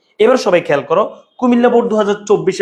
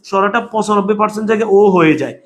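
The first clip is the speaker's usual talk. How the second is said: ordinary talk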